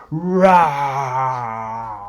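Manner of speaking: low growling rawr; grumpy low bear growl; bear roar. A narrator's reading. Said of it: "bear growls and he grumbles".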